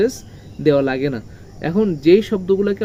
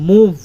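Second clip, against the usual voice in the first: মুভ louder, with weight on m and v; stress on MOVE; 4 semitones higher, 9 dB louder, much slower than usual. Shown as a demonstration. Teacher giving an example, stressed মুভ. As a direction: punch the word মুভ. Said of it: "ম এর উপরে stress রয়েছে".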